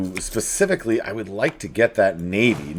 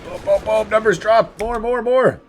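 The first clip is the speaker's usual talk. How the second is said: excited gloating; 10 semitones higher than usual. Real, not acted. Gloating over battle.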